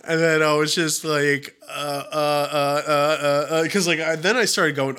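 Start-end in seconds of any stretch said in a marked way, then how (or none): 1.7-3.7 slow, repeated stuck "uh"; mock brain-freeze, stalling on one sound; frozen stammering. Shopper freezes in front of a phone saleswoman, stammering. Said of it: "like, freezing".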